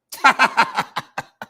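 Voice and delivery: high, rapid burst of laughter; long cackling laugh; hearty laugh. Real, not acted. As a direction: crack up at your own joke. Cracking up at his own joke.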